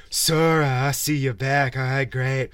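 a hint of a solemn cult leader; mock villain speech. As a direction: parody a pompous RPG villain's speech. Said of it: "they're like".

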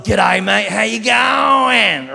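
loud, drawn-out Aussie greeting; big Australian shout, last word stretched high. Acted. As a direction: holler it in a broad, hammed-up Australian accent. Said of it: "That's so Australian".

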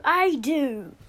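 hammed-up storybook protest; insistent cry. A narrator's reading. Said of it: "cried Lou".

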